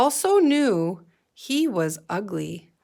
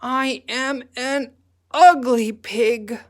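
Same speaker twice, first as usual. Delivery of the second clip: slow put-on pig voice, glum; a pig moping about his looks; sad pig voice; 4 semitones higher, 5 dB louder than usual. Gilbert voiced in story.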